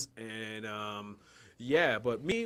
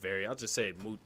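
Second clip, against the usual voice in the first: ordinary talk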